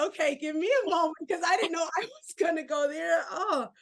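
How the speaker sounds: fighting back tears, asking for a moment; tearing up. Real, not acted. Host moved to tears by guest's story, voice breaking. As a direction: voice catching on real tears mid-interview. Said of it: "I'm tearing up".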